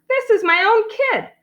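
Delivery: high-pitched, indignant father; indignant protest. A narrator's reading. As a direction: protest in disbelief.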